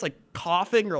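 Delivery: normal talking voice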